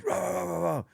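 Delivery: high rolling mock bark; imitated dog barking. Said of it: "it barks at them".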